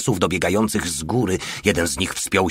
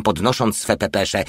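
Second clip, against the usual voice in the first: plain reading voice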